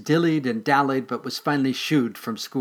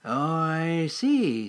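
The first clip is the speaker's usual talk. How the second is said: sly mole voice; 5 semitones higher, much slower than usual. Acted. Mole character voice.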